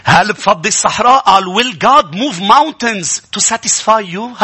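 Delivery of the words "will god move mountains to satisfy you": very slow emphatic reading. Acted. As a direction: drag each word out, very slowly. Reads English verse very slowly. (قراءة بطيئة جدًا ومشددة)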